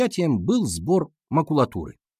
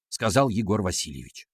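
ordinary delivery

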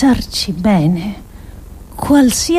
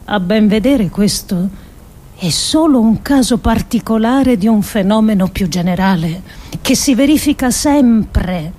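usual speaking voice

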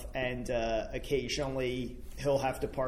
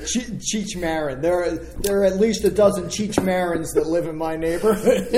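laughing; 3 semitones higher, 8 dB louder than usual. Real, not acted. Laughing banter about accent.

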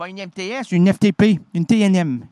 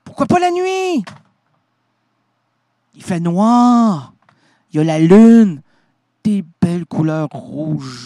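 storytelling; 3 dB louder, slower than usual. Acted. Animateur raconte un conte d'arc-en-ciel.

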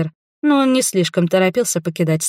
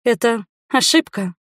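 higher, hesitant protest; babbled helplessly; 4 semitones higher than usual. A narrator's reading. Duchess explaining missed invitations, babbling helplessly. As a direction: offer it weakly, flustered. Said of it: "пролепетала я".

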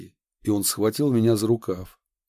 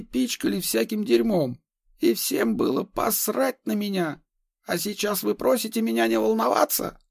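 agitated madman; 12 semitones higher than usual. Acted. Madman lashes out at doctor.

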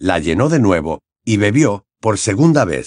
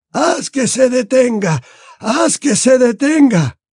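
high, slow, anguished plea; drawn-out suffering wail; pleading murmur; slower than usual. A narrator's reading. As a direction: beg for the torment to stop. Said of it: "murmuró Dumbledore".

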